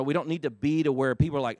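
ordinary delivery

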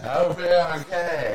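slow, laughing mimicry of "I'll be okay"; mock wobbly reassurance. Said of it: "mock echo of friend".

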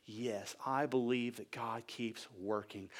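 low, soft, slow affirmation; hushed conviction. Real, not acted. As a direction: bring it right down, sincerely. Hushed confession of belief.

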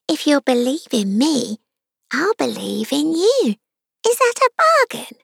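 high unicorn voice, rising into the question. A narrator's reading. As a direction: offer a deal in a put-on high voice. Unicorn proposes bargain in put-on voice.

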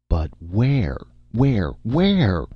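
escalating, frantic questioning; exasperated demand. A narrator's reading. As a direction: press harder with every word. Director frantically demanding answer.

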